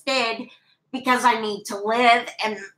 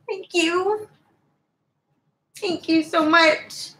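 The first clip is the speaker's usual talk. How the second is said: real tears; 4 semitones higher, slower than usual. Real, not acted. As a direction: voice breaking into real crying, slow. Moved by a viewer's kind comment.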